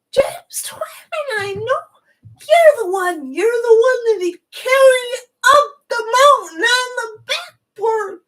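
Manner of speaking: high, loud, choked-up shouting; still yelling. Retold or imitated. Stranger at the party blurting out what he knows, high tearful shouting. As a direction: cry out the memory as if it can't be held in. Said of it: "yelling at the top of his lungs".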